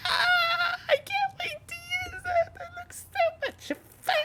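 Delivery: squeaky high mock-thrilled squeal; high-pitched fake delight at a shiny gadget; mock giddy excitement. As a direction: gush like a kid over a new toy. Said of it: "you're like".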